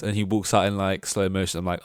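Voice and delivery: normal talking voice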